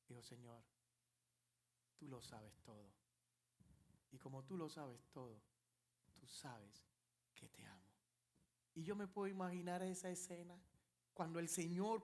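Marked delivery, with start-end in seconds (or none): 0.2-7.9 soft, low, fading into a whisper; broken whisper. Acted. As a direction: confess it barely audibly. Peter answers broken.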